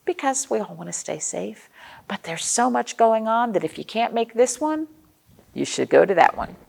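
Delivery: usual speaking voice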